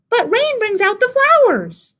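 very high little mouse squeak; teeny high-pitched mouse voice; tiny mouse. A narrator's reading. Mouse cheers Rabbit up.

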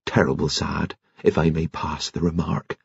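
soft, respectful condolence; hushed postman offering sympathy; special solemn voice. A narrator's reading. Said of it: "he said in a special voice".

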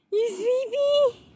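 high, slow cooing question. Real, not acted. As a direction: coo sweetly to a sleepy dog. Baby-talking to the tired dog.

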